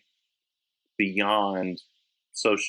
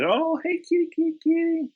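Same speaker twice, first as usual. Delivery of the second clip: put-on pet baby talk. Greeting a friend's house cats.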